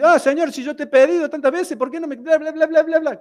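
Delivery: high, loud mock whining at God; whiny complaining prayer. (burla de una oración quejosa, aguda y fuerte)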